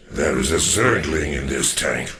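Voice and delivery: ordinary delivery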